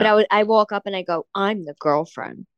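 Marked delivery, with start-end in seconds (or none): 1.3-2.5 low, slow mock introduction; retold confrontation. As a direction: state your claim slowly, dropping the pitch.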